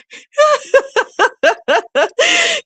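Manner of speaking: high, loud cackling laugh; hearty laugh. Real, not acted. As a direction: burst out in high peals of laughter. Laughing at own embarrassing story.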